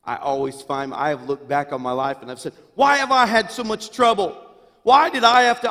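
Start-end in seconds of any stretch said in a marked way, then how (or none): 2.7-5.7 high, loud aggrieved wail; self-pitying complaint. Retold or imitated. Pastor reliving his complaint to God, loud self-pitying wail. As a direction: cry out why me, much higher and louder.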